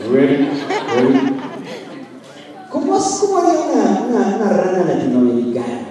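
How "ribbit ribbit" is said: a light croaky English frog call, imitated; gringo frog ribbit. Preacher showing the church how young people imitate a frog, mock ribbit. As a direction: mimic an American frog's ribbit. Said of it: "ese sonido de una rana gringa".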